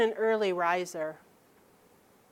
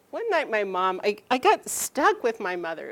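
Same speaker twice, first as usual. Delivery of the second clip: ordinary delivery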